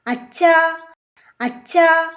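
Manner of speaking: lightly voiced child calling Dad; mild questioning call to a parent; calling out to father. A narrator's reading. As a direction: call for your father, drawing it out a little.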